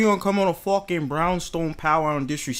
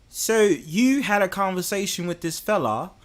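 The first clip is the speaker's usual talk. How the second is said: slow put-on London voice; New Yorker putting on a London accent; 5 dB quieter than usual. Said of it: "should I speak in my London voice".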